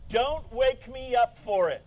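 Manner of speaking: ordinary talk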